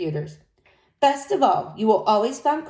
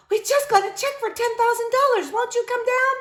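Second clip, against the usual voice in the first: much higher, eager employee; high pleading voice; 14 semitones higher than usual. Acted. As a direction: plead brightly over the phone.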